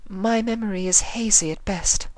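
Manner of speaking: delicate hazy voice; Rarity, dazed. A narrator's reading.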